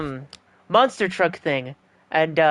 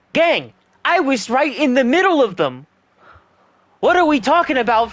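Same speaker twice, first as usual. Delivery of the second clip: excited; 7 semitones higher, 3 dB louder than usual. Real, not acted. Still shocked at the first-place win.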